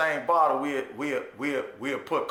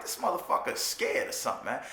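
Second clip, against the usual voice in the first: whispered scoff; 7 dB quieter, faster than usual. Retold or imitated. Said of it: "I'm like".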